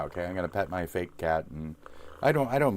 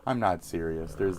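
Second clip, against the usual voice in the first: ordinary delivery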